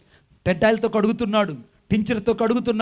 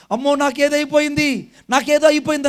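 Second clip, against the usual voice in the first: panicked cry; 6 semitones higher, 6 dB louder than usual. Retold or imitated. Panicking patient cries out.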